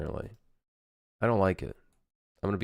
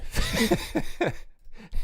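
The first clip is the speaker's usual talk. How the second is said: breathy laugh. Real, not acted. Weird emotes amuse him.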